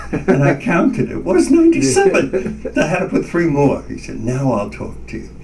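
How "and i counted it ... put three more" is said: high, laughing disbelief. Real, not acted. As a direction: laugh at the count.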